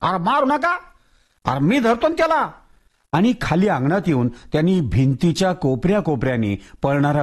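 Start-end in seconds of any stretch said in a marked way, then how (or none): 0.0-2.5 much higher urgent call to stop; Tatya shouting. Acted. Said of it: "तात्या एकदम ओरडून म्हणाला".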